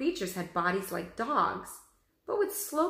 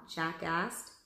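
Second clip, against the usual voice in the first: plain reading voice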